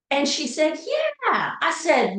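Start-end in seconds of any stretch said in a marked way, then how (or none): 0.8-1.6 put-on a child's yeah, much higher; bright child's yeah. Daughter confirming to her mom, high and proud. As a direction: chirp it as the proud little girl.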